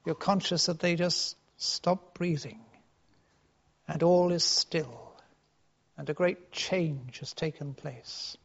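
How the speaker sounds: ordinary talk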